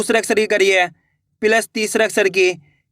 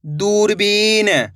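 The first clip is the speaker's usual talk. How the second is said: one slow, unbroken word; blended word; much slower than usual. Shown as a demonstration. Teacher showing what the syllables make, slowly blended. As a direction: join the pieces and stretch the word. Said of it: "तो मिलके बन जाएगा".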